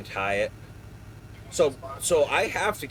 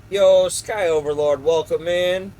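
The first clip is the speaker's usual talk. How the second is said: loud, hyped welcome shout; excited greeting; 5 semitones higher, 7 dB louder than usual. Real, not acted. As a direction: call out the name like a hype host. Streamer greets new viewer.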